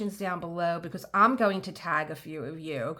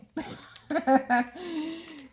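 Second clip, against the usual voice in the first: laugh; 9 semitones higher, 3 dB louder than usual. Real, not acted. Laughing at herself.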